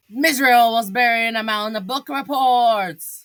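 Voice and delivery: plain reading voice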